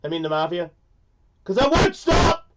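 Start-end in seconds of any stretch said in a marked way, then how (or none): 1.4-2.5 much higher, louder, slow defiant cry; mock defiant shout. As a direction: yell it like nobody can silence you. Defiant joke about mafia silencing.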